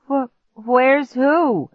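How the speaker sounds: high, slow, halting question; stammering. A narrator's reading. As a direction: stumble on the first word, bewildered. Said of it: "Clark stammered".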